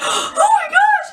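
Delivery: high, drawn-out mock alarm; hammed-up shock; scared onlookers. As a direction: exclaim like frightened bystanders. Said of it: "they're getting really scared".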